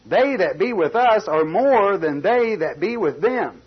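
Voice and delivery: slow, loud and ringing; preaching at full force. Acted. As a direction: proclaim it as the climax. Climactic scripture line preached.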